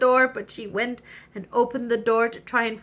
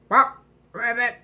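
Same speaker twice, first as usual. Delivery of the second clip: frog croak; 4 dB louder than usual. Acted. Frog at the door.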